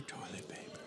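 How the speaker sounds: soft breathy echo; echoey whisper. Retold or imitated.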